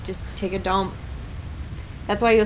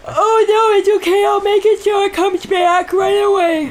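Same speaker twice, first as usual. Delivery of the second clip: mock godlike voice; 13 semitones higher, 8 dB louder than usual. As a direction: boom it out like a playful deity. Joking as game controller.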